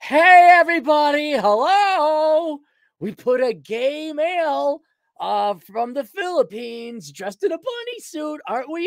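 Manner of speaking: high, loud, hammed-up preening brand voice; mock corporate voice. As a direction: lampoon a company bragging how edgy it is. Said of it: "Same thing Playboy's doing".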